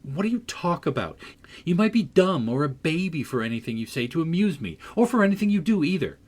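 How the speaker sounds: high, irritated outburst, building in pitch; growing irritation. A narrator's reading. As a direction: build from annoyed demand to quick, high scolding. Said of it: "growing more irritated".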